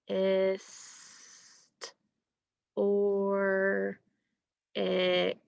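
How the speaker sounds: "ist, or, ick" stretched into separate sounds; slow phonics sounding-out of "ist, or, ick". Shown as a demonstration. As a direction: hold each sound of "ist, or, ick" with long gaps. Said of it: "how many sounds are in the".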